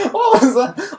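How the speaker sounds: high, loud burst of real astonishment; amused disbelief. Real, not acted. Viewer reacting to a botched animation clip, loud amused disbelief. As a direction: blurt it out high and loud, cracking up.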